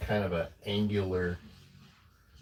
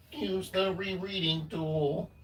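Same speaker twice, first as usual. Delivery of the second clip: slow, higher robot voice; 10 semitones higher, slower than usual. Pretend. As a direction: say it like a stiff robot, slowly. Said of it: "That's my robot voice".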